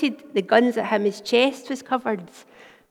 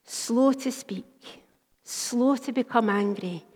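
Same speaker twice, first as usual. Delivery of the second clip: slow, soft, deliberate verse; emphatic scripture; 4 dB quieter, slower than usual. Acted. Preacher quoting James to the congregation, slow. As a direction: let each phrase land slowly.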